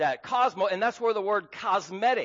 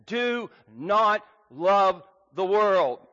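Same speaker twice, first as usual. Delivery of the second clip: NOT punched, whole line slow and louder; emphatic; 4 dB louder, slower than usual. Shown as a demonstration. Preacher driving home John's command, slow stress on not. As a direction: hammer "not" in "Do not love the world". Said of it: "it's very emphatic".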